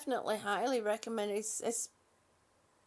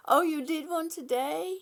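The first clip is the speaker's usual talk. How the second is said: ordinary delivery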